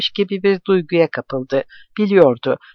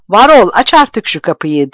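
loud, higher yell at the door; shouting with all his might; 5 semitones higher, 6 dB louder than usual. Acted. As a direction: put everything into the shout. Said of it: "var gücüyle ... diye bağırdı". (var gücüyle bağırış)

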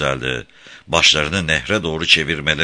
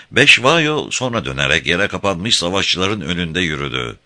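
plain reading voice

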